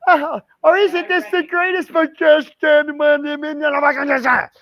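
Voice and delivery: hammed-up high wife impression; mock wife voice. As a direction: squeal it like his wife mid hot flash. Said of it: "She'd be like".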